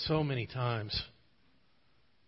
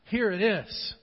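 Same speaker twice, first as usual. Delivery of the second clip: raised, slow, pleading confession; David's confessing prayer; 4 semitones higher, 4 dB louder, slower than usual. Acted. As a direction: pour out the heart in slow, broken pleas. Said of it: "He wasn't afraid to say".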